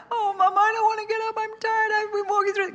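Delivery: put-on child whining to mom about getting up. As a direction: play a tired teen begging to stay in bed.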